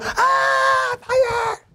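very high, drawn-out mock scream; mock panicked scream. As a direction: scream the alarm in pretend panic. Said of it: "I don't have to scream it".

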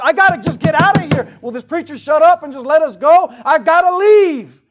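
high, loud, desperate cry; voicing a restless listener. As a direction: beg to escape the sermon. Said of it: "fighting with everything you've got".

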